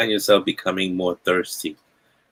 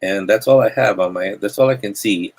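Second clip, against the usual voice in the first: ordinary talk, nothing marked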